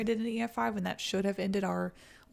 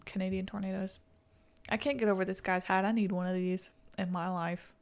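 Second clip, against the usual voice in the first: usual speaking voice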